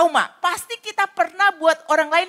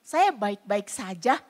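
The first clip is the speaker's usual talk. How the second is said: soft, slow, lower insistence; quiet reassurance; 6 semitones lower, 7 dB quieter, slower than usual. Retold or imitated. A churchgoer telling the pastor they are fine, soft and slow. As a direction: murmur that all is fine. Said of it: "Ada orang-orang yang berkata".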